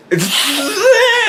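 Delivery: high-pitched laughing squeal. Real, not acted. Cracks up retelling the story.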